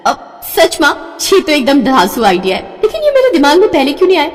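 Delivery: high, fast delighted rush; overjoyed daughter, words tumbling out; a girl bursting with excitement; thrilled squeal. Acted.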